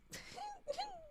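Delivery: a sudden high squeaking laugh; squeaky laugh. Real, not acted. Streamer laughing at her cracked voice, high squeak. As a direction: laugh that jumps way up. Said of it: "More Emperor's New Groove quotes".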